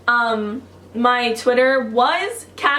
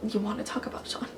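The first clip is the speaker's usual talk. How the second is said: much softer, lower-effort aside; hushed mock quote; 3 semitones lower, 13 dB quieter, faster than usual. Vlogger dismissing her own wrong guess, hushed mock aside. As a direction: toss it away under your breath.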